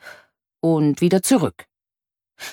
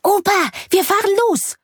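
put-on high-pitched excited little boy; much higher, thrilled child voice; excited call. A narrator's reading. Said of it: "ruft Finn aufgeregt".

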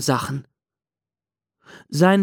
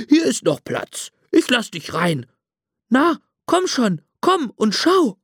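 hoch und schmeichelnd lockend; lockender Straßenhund; 8 semitones higher than usual. A narrator's reading. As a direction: lock ihn freundlich-listig ins Fass. Hund Pablo lockt den Pinguin. (hohe, lockende Hundestimme)

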